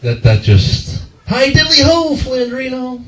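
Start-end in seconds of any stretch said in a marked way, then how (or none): 1.3-3.0 high-pitched teasing singsong greeting; high, sing-out taunt; mocking greeting. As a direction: squeal the greeting to mock someone's surname. Mocked with Ned Flanders greeting.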